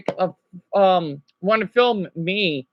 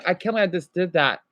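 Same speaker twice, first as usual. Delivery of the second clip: usual speaking voice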